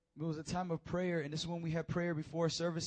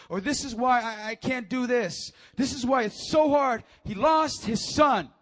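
loud, high, building to a shout; full-force preacher, slowing on the final declarations; impassioned preaching; 7 semitones higher, 13 dB louder than usual. Acted. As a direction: hammer each line home to the congregation.

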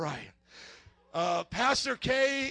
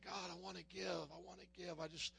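soft retold prayer; 14 dB quieter than usual. Quoting his own prayer.

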